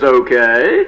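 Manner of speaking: low, calm God imitation; big voice of God. God replies to panicking man, big deep voice. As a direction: answer deep and unruffled as God. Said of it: "there was this big voice".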